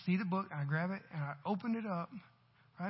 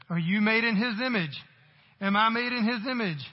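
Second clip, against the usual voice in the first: louder rhetorical questions, higher pitch; preaching loud; 5 semitones higher, 8 dB louder than usual. Acted. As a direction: call it out to the crowd. Preacher challenges the congregation.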